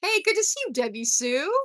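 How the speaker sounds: high, loud cheerful hello; cheery greeting. Pretend. Host joking a greeting to a viewer, bright and loud. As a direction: greet an old friend brightly. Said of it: "I was gonna say".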